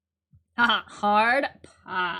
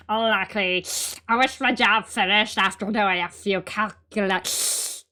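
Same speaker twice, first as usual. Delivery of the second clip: cartoon princess. Voices Luke in princess voice.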